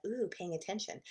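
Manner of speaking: soft, intrigued little "ooh"; a curious inner voice noticing something shiny; hushed and intrigued; perked-up subconscious. Pretend. Subconscious mind perks up.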